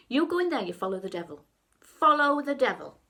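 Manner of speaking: acted scolding mother, loud, building to the last phrase; mum's stern warning. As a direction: lay down a loud, forbidding warning as Mum.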